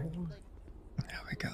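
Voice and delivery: whispered, slow; whispering. Real, not acted. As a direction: breathe it out under the breath. Muttered aside while paying.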